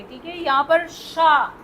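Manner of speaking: normal talking voice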